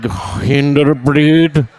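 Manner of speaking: put-on drawn-out name in Indian accent; slow Indian accent. Store clerk tells the drunk customer her name in an Indian accent. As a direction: say your name slowly and flatly.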